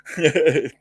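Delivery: a short delighted laugh; happy laugh. Real, not acted. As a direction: laugh with pleasure at seeing her. Laughs after greeting.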